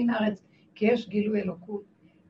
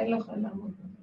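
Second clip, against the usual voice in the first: ordinary delivery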